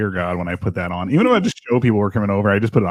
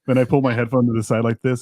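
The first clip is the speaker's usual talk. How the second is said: usual speaking voice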